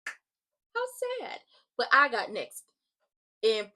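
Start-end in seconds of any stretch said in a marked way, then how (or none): 0.1-1.4 high, mock-pitying coo; mocking Aleister. Aleister taunting Carmelo after the finale, high mock pity. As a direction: sneer it in a high sing-song. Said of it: "Aleister was like".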